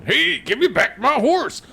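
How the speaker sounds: high, loud cowboy holler through a laugh; laughing cowboy yell. Retold or imitated. Mocking the striking voice actor with a laughing cowboy holler. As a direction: yell it again while cracking up. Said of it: "that guy's not gonna be going".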